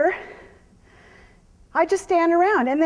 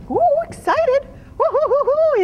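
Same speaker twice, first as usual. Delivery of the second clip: much higher, bright whoops; cheering the horse on; 11 semitones higher, slower than usual. Real, not acted. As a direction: whoop playfully at the running mare.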